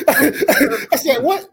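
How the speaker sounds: high, loud words through laughter; laughter bursting into the words; laughing in disbelief. Real, not acted. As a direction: crack up while retelling it. Laughs through telling the story.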